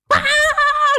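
high laughing mock scream of fright; a frightened woman's yelp; startled shriek. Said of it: "langsung teriak".

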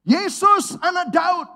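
high, loud cry of Yesus, anak Daud; the blind man crying out to Jesus with all his strength; desperate shout. Retold or imitated. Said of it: "teriak dengan segenap tenaga".

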